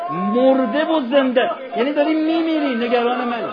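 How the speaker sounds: high, loud, anguished preaching; impassioned lament. Real, not acted. Preacher marvels at dying Abbas's loyalty, impassioned lament. Preacher moved, mourning congregation.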